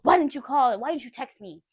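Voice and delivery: mock angry demand, voice jumps up; blowing up. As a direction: imitate a girlfriend's loud accusation. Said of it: "it all blows up".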